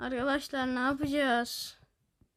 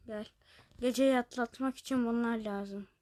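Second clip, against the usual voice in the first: ordinary delivery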